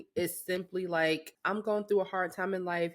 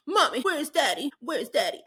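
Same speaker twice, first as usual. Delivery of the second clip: put-on high, loud little girl crying for daddy; distraught child; 8 semitones higher, 5 dB louder than usual. Toddler begging her mom to find daddy, high and whiny. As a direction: play the upset toddler. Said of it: "she is distraught".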